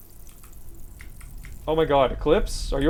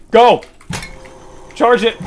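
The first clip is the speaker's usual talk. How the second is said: yelled urgent command; shouting; 6 semitones higher, 8 dB louder, slower than usual. Real, not acted. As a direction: bark the order at full volume. Yelling at friend to charge.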